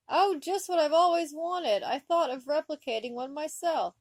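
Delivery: high and loud, overjoyed mother; gushing delight. Acted. Mother thrilled at her own gift to her son, gushing. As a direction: gush over the gift as if it is perfect. Mother gushes over ugly sweater.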